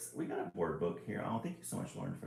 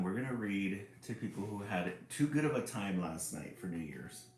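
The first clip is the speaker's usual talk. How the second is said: usual speaking voice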